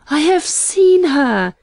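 high, loud, drawn-out ecstatic cry; elated cry, stretched out. A narrator's reading. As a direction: burst out with rapturous news.

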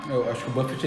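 usual speaking voice

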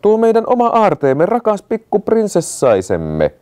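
a high, lightly voiced fond parent, slowing at the end; a doting royal calling out to the child. A narrator's reading. The king speaks fondly of the little princess, high and lightly voiced.

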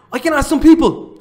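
excited gasp. Real, not acted. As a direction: blurt it out like a eureka. Sudden idea, gasping.

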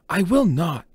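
high, loud defiant refusal; determined refusal. Acted. Pinned scythe refusing his attacker, determined and loud. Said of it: "determined not to give him the satisfaction".

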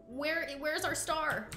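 high, drawn-out searching call; imitating crew hunting for their star; voicing the film crew. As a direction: call out slowly like a puzzled crew. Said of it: "Everyone in set's like".

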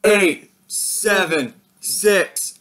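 loud, evenly spaced countdown; countdown call. Real, not acted. Host counting down to New Year, loud call. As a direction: call each number out for a crowd.

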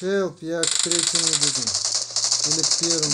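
loud high cry for help; panicking gamer. Real, not acted. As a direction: yell for help under fire. Firefight, asks for help.

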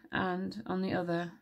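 plain reading voice

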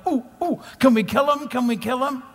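high, giddy, eager king; excited. Retold or imitated. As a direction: bounce like an eager kid. King jumping up and down.

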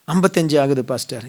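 low, soft acted answer; meek reply. Believer tells pastor his age, acted meek and quiet.

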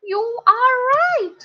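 high, loud, impressed schoolboy; Peter. A narrator's reading. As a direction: pitch it way up in amazement. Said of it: "Peter said".

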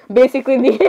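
words dissolving into laughter; can't stop laughing. Real, not acted. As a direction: try to stay serious, then crack up. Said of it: "I can't stop laughing".

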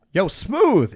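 high, loud summons; calling out. Retold or imitated. Club worker calls his bouncer over, calling out. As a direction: holler for the big guy across a room.

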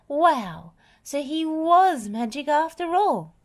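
high, wondering exclamation; amazed. A narrator's reading. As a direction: marvel that it was real magic. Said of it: "he gasped in amazement".

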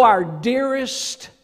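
ordinary talk, nothing marked

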